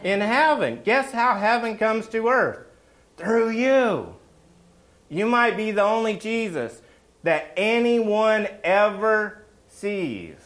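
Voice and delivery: high, loud preacher's climax; rally the congregation with passion; fiery preaching. Acted. Preaching at full force.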